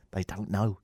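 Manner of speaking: low, soft aside; hushed aside. Acted. Host confiding about listeners in America, hushed aside.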